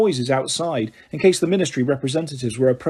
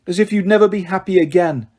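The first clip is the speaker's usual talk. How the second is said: plain reading voice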